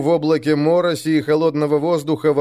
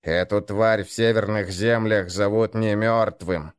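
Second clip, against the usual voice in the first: ordinary delivery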